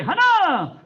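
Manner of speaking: high, loud calls with long falling tails; shouted drill commands. Real, not acted. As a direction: bellow each command, stretching the last vowel. Instructor calls out the count.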